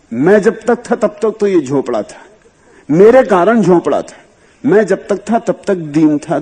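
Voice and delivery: impassioned sermon; fervent preaching. Acted. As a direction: proclaim it to the listeners. Discourse rises to emphatic preaching.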